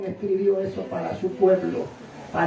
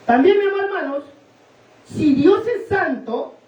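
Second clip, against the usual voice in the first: emphatic preaching; 8 semitones higher than usual. Real, not acted. Predica con fuerza. (predicación enfática, aguda y pausada)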